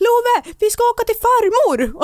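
put-on high, loud call to a small child; imitated excited announcement. Imitating dad telling their child about going to grandma's, excited call. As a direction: pitch it right up like big news for a child.

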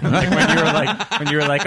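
ordinary talk, nothing marked

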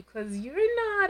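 high, slow teasing jab; teasing quote. Retold or imitated. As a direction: drag out the tease. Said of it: "his sister-in-law is like".

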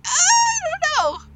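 high, drawn-out dithering wail; hammed-up torn whine; exaggerated indecision. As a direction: agonize loudly over it, high and stretched. Torn over whether reindeer counts.

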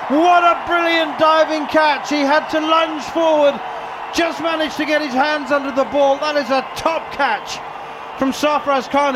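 loud, high, slowed live call; excited catch call. Real, not acted. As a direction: shout the catch as it happens.

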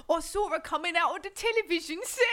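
hammed-up Cockney through laughter; mock Cockney. Picturing the horror film with the model, laughing mock Cockney. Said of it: "She's a Cockney".

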